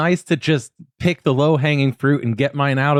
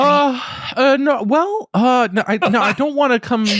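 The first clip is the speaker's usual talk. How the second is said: flustered; 11 semitones higher than usual. Real, not acted. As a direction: backpedal in a fluster. Flustered denial.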